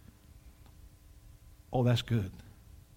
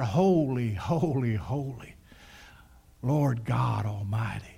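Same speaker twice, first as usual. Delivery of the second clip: ordinary delivery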